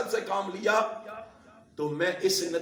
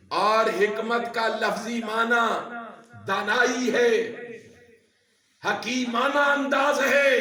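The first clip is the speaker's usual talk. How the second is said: preaching that builds higher and louder; emphatic preacher, voice climbing; rising sermon delivery; 4 semitones higher, 5 dB louder than usual. Real, not acted. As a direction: lift each phrase toward a shout.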